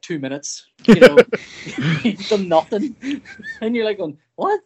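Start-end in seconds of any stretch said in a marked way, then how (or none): 4.3-4.7 surprised. Retold or imitated. Retold shock at the timer.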